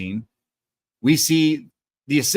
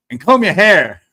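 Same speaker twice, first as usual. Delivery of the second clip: higher, louder film quote; emphatic parting line; 7 semitones higher, 9 dB louder than usual. Retold or imitated. He ends the scene with a parting line, emphatic. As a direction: mimic an emphatic movie line.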